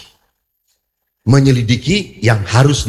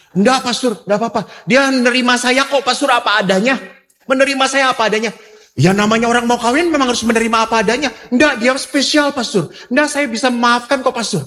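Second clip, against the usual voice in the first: higher, eager, insistent protest; pleading young woman; 10 semitones higher than usual. Retold or imitated. Woman begging the pastor not to judge her fiance, pleading. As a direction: keep defending him, pleading high.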